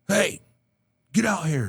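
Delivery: high-pitched call to someone out of sight. Retold or imitated. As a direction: imitate a man summoning his dog. Imitates man calling dog out.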